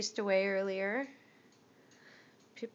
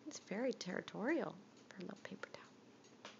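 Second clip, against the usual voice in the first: quick hushed aside; whispering; 8 dB quieter, faster than usual. Real, not acted. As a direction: whisper it fast to the camera.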